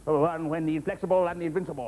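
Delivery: ordinary delivery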